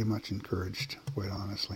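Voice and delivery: whispered trail-off. Real, not acted. Guest trails off to the host in a soft whisper. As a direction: let it fade into a whisper.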